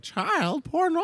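high, breathy mock model. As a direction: squeak it like a naive model. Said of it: "she's like".

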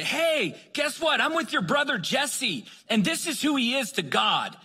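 high-pitched, exuberant voicemail greeting; excited announcement. Retold or imitated. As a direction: announce big news in a high, eager voice. Excited voicemail to man's sister.

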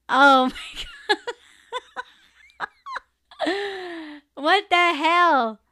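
high, slow, loud what the hell; laughing disbelief, dragged out; shocked disbelief. Real, not acted. Host reading a wild chat comment, loud disbelief.

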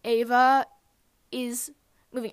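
word by word, with a pause before the end; loud first word, then get the rest out separately; retold careful telling. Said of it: "take three deep breaths and then just tell me".

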